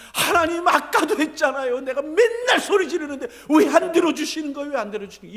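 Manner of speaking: high, upset outcry to God; loud protest. Acted. David complains to God about unanswered cries, in loud protest. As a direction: protest loudly, fed up with being unheard. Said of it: "하나님 앞에 항의하고 있잖아요".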